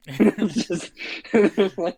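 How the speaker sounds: laughing as he talks; words broken by laughter. Real, not acted. Laughing mid-sentence.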